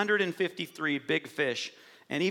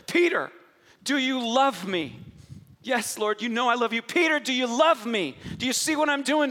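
louder, higher, impassioned preaching; preaching at full force; 6 semitones higher, 5 dB louder than usual. Real, not acted. Pastor preaching to the congregation about Peter's redemption, full-force and impassioned. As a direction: build it into a soaring altar call.

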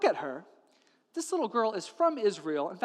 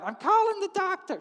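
very high, agitated wife; shrieking insistence; 12 semitones higher, 4 dB louder than usual. Acted. Wife overruling her husband, shrill insistence. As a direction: repeat it in a high, frantic pitch.

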